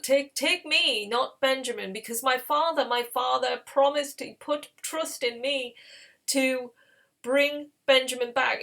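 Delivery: acted impassioned appeal to a ruler; dramatic plea. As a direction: plead earnestly for a brother's life. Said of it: "that very dramatic ending".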